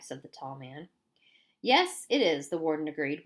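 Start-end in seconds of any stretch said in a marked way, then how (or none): none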